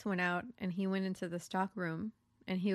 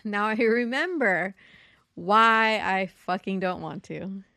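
exasperated; 10 dB louder, slower than usual. Retold or imitated. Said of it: "I was like".

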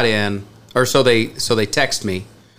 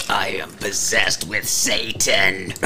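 mock demonic voice; 4 semitones lower, 5 dB quieter, much slower than usual. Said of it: "he said it pretty loud".

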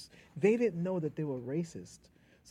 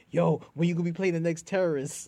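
louder, lightly voiced buddy from the block; neighborhood friend; 2 semitones higher, 7 dB louder than usual. Friend ribbing the actor about roles, loud buddy voice. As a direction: call it out like a teasing pal. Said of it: "when your friends would be like".

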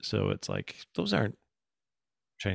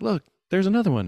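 higher, louder re-enacted discovery; excited retold call; 11 semitones higher, 8 dB louder than usual. Imitating stepdaughter spotting another orb, excited call. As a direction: call out a new sighting, acting it out.

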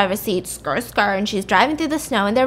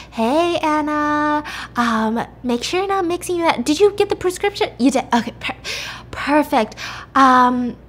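doctor's voice; 6 semitones higher than usual. Retold or imitated. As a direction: voice the doctor tiptoeing around the point. Doctor voiced in retold story.

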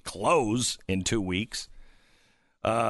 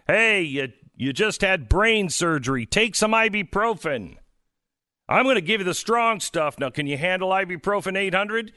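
mock doctor; 7 semitones higher than usual. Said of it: "doctors voiced offering ibuprofen".

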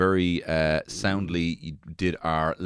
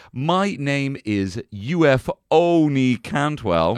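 grand announcement; 6 semitones higher, 7 dB louder, much slower than usual. Acted. Mock grand self-introduction.

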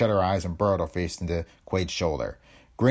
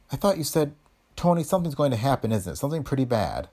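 worried; 6 semitones higher than usual. Acted. Despairing realisation.

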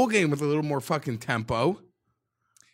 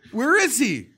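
laughing taunt; 9 semitones higher, 4 dB louder than usual. Real, not acted. Taunting the player, half laughing.

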